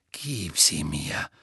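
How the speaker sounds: deep, slow menacing growl. A narrator's reading. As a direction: growl it low in his ear. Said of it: "knurrte eine Stimme".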